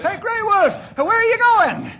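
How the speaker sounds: high, loud shout after someone; loud alarmed call across the shore. Acted. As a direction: yell after him as he runs off. Calling after departing companion.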